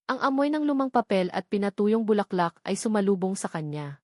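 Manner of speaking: ordinary delivery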